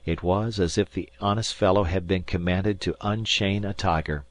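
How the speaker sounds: usual speaking voice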